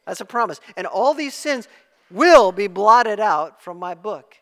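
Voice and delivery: loud, emphatic preacher's assurance; forceful preaching. Acted. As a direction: drive the promise home to the congregation.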